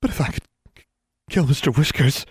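halting, high voice near tears; upset, faltering confession; unsteady, tearful. A narrator's reading. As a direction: break off mid-sentence, pitch rising. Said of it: "her voice became steadier".

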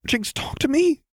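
high desperate plea; pleading. Acted. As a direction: beg her to open up. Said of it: "Lux pleaded".

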